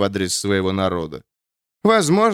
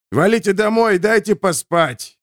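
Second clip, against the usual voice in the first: angry shout; 9 semitones higher than usual. A narrator's reading. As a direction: bark it down at the enemy. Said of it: "огрызнулся Рыжий".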